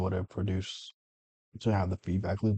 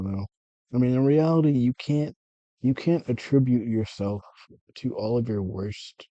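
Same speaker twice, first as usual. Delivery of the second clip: ordinary talk, nothing marked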